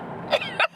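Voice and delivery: high giggle; amused laugh. Real, not acted. Cracks up at own joke.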